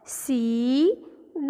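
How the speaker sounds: very slow સી, pause, then ડ; blended reading. Shown as a demonstration. Teacher asking children to read along, slowly sounded out. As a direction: draw out સી, then add a separate ડ. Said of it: "ચલો, ફરીથી વાંચન કરો".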